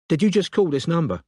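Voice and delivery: a wary Londoner questioning; London accent. A narrator's reading. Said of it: "She had a London accent".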